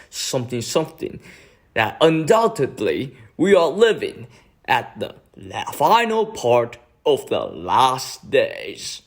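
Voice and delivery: slow, swooping mock sermon; mocking preacher impression. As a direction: intone it like a pompous cult leader. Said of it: "it's not very accurate one. Let's try again".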